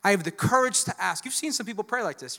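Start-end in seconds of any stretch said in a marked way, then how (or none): 0.1-1.2 put-on loud, pleading old woman's prayer; old woman praying for groceries on her porch; bold prayer. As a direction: ask God boldly out loud. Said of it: "she was like".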